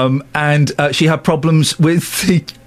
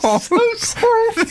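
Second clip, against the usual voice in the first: high apology through laughter; laughing apology. Real, not acted. Cohost can't stop laughing at complaint, giggling apology. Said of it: "I don't know why I'm laughing".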